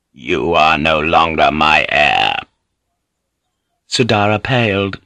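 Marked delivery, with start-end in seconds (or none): 0.1-2.4 low, slow, stern lord; remorseless verdict. A narrator's reading. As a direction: pronounce it with cold finality. Lord disinherits his son.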